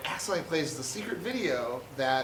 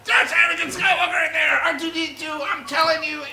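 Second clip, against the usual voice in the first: high, loud urgent insisting; insistent shout; 12 semitones higher, 7 dB louder than usual. Retold or imitated. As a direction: yell it like nobody listens. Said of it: "He's constantly saying".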